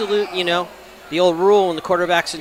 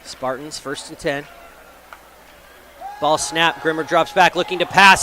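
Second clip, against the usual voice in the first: usual speaking voice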